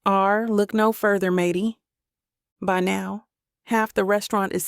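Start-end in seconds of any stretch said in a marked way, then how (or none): none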